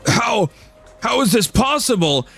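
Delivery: high, stunned disbelief; shocked being. Acted. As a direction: squeak out the shock.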